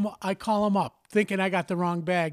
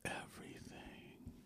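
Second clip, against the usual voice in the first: a dry, unvoiced aside; hushed emphasis; 22 dB quieter, slower than usual. Real, not acted. Telling a friend about his controlling dad, whispered. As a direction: whisper the word for effect. Stressing father's need for control.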